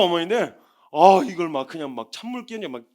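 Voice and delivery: normal talking voice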